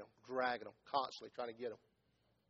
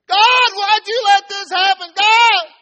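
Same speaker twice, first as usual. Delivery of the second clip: much higher, much louder anguished cry to God; retold scream; 27 dB louder, slower than usual. Pastor voicing a drunk mother at a crash, screaming. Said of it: "a cornfield screaming".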